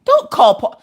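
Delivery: mother stopping her daughter's call; urgent plea. Retold or imitated. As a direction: beg her not to call.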